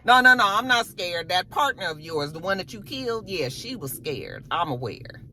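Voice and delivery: normal talking voice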